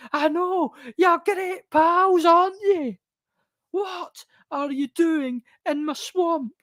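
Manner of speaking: high, slow falsetto Shrek-movie voice; mock whiny movie character, way up high; squeaky cartoon voice.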